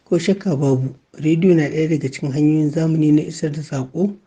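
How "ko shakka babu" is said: normal talking voice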